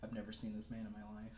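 fast, soft denial; quick mumbled denial. Acted. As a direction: rattle it off dismissively. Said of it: "Alan's just like".

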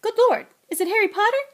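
high, quick, loud amazement; astonished gasp of recognition; startled. A narrator's reading. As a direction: burst out on spotting the guest. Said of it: "and jumped".

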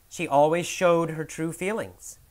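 normal talking voice

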